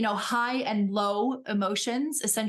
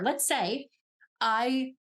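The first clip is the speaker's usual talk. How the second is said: normal talking voice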